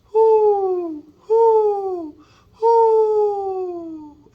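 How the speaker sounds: high falsetto hoo, last one falling off; high hooting dove call, letting the last fall. Shown as a demonstration. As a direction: coo like a dove at night. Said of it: "it will go".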